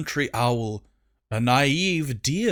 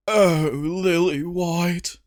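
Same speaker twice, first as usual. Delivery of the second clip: slow, lovestruck sigh; swooning; 4 semitones higher, slower than usual. Acted. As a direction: swoon over the poster. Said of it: "stood in his swoon".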